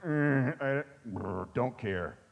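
low, mumbled don't-care grunt; bored shrug. Retold or imitated. Pastor imitating churchgoers who shrug off theology. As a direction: shrug it off, clearly uninterested. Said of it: "Some of you are like".

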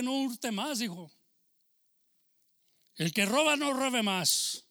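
loud, stretched-out pulpit cries, slowing hard on the warnings; fiery preaching. Acted. Pastor warning the church against lying and stealing, loud fiery preaching. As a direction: preach it at full force to the congregation.

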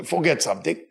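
ordinary delivery

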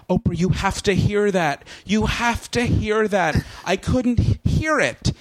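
mock fake crying. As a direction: plead like a fake-crying guest, slow. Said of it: "like, fake cries".

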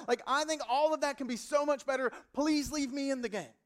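loud, high pleading sermon voice; impassioned preaching. Acted. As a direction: pour everything into the plea to God. Preaching at full force.

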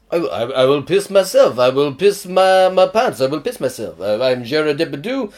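put-on comic French accent, rising then dropping; Gerard Depardieu. Drunk actor threatening the crew, mock French accent. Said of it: "That's my French accent".

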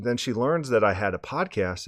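ordinary talk

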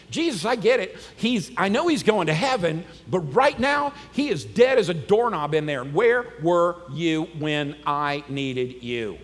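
loud, worked-up complaint; loud exasperation slowing to a pointed demand; intense. Retold or imitated. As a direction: confront him, loud and insistent. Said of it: "Martha, being the intense one".

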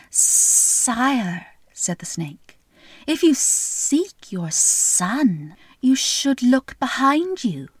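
slow, sly snake voice; a lightly voiced, unhurried snake. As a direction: draw out every word like a slithering snake. Snake voiced in fairy tale.

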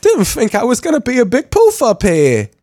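high mock blubbering; hammed-up weepy whine; mock crying. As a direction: parody a teary Brit. Mocking crying Bale.

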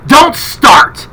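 a sharp retold snap at the lady; snapped rebuke. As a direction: bite her head off, imitating him. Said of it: "just snapped at her".

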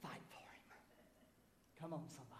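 sly demon's whisper in the ear. Acted. Little demon urging Peter to fight, whispered. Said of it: "a little demon in his ear saying".

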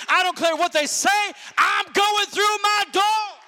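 high, driving revival preacher's cry; full-force preaching. Real, not acted. Preacher rousing believers in the house, full-force preaching. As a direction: build to a shouted declaration of faith.

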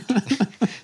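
high airy laugh; a quick amused laugh. Real, not acted. Painter laughing at his own punchline, high laugh.

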